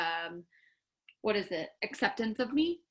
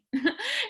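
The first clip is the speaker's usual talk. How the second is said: sharp high laugh; 14 semitones higher, 4 dB louder than usual. Real, not acted.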